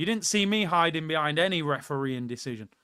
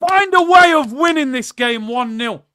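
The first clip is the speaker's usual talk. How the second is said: loud, high desperate yell at the team; frustrated full-volume shout; 7 semitones higher, 13 dB louder than usual. Real, not acted. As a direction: bellow it at the players. Yelling at his team.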